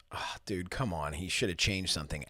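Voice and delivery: low, hushed grumble to oneself; soft, quick, disappointed aside; muttered disappointment. Retold or imitated. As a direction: mutter a let-down first reaction under your breath. Retold disappointed first reaction to music.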